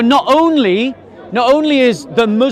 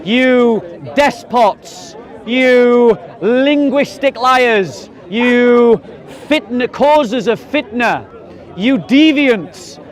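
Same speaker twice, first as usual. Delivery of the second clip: slow, drawn-out name-calling; mock denunciation; much slower than usual. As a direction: stretch out each accusation like a public denouncer. Mock message denouncing the translators.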